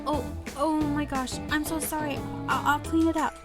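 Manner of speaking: high, stammering panic; flustered apology. Acted. Heroine spilled latte on his suit, flustered apologizing.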